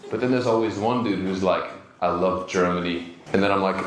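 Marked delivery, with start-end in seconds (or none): 2.0-3.0 lightly voiced low-pitched Germany fan; a hint of a low, ominous admirer; creepy fan. Creepy fan telling the comedian he loves Germany, low and ominous.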